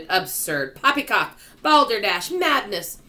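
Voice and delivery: loud, high volley of dismissals; outraged exclamations; scornful shout. A narrator's reading. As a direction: shout each word like a heckle. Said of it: "they shouted".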